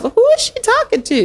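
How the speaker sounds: high-pitched disbelieving question; incredulous. Retold or imitated. Mentor privately baffled by young woman's question, high and incredulous. Acting out her incredulous thought.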